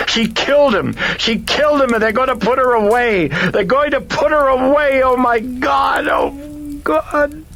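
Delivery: high, loud, desperate shriek. A narrator's reading. Chris shrieks at the priest that her daughter killed a man. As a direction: shriek it at him in despair.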